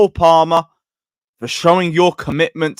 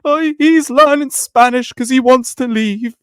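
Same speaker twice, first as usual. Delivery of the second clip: higher, whiny mock panic; mocking scaremongers; 9 semitones higher than usual. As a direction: parody the doom-mongering fans. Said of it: "Shut up. Scaremongering everywhere".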